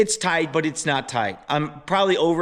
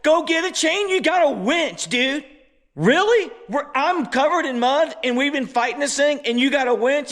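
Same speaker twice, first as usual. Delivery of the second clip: higher, incredulous mock outburst; can't believe what I'm hearing, pitched way up; exasperated; 8 semitones higher than usual. Me, mud-covered, scolding my buddy about his winch, higher and incredulous. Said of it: "I go".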